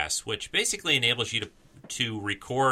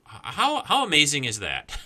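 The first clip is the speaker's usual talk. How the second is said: amused surprise; 5 semitones higher than usual. Real, not acted. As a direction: react to the phone ringing. Phone rings mid recording.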